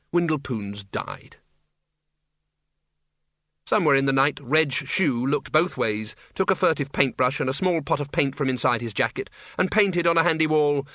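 ordinary delivery, nothing marked

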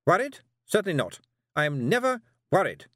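high, sharp indignant snap; snapping. Acted. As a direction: snap it back, offended. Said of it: "snapped Miss Tick".

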